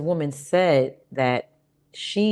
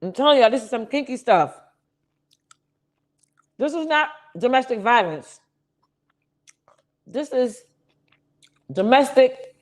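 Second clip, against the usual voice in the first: excited; 9 semitones higher than usual. Real, not acted. Reacting to a kinky video.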